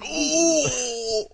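high pained yelp like a brute just kicked in the groin; brute kicked in the nuts. Retold or imitated. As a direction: yelp in sudden groin pain. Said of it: "If I was a brute and I got kicked in the nuts".

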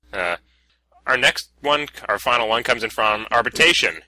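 ordinary talk

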